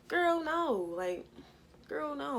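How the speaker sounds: loud, high dismissal of her worry; incredulous girl no. Retold or imitated. Telling Tracy her worry is silly, loud disbelief.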